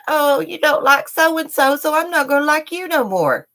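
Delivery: sing-song mock of childish people; mocking haters. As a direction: sneer it like a petty child.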